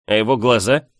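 insistent, leading question; pressing question. A narrator's reading. Friend presses girl about the duke's eyes, insistent. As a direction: press her on what she saw.

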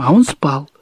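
plain reading voice

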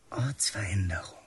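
whispered secret plotting; conspiratorial whisper. Acted. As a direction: keep it hushed so nobody hears. Boys plot escape secretly.

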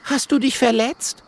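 high, concerned question; high-pitched animal character; high critter voice. A narrator's reading. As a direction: ask after a friend in a small high voice. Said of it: "sagt Mathilda freudig".

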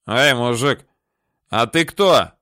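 put-on loud drunken call. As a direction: shout it drunkenly into the dark. Drunk calls out from darkness.

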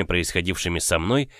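usual speaking voice

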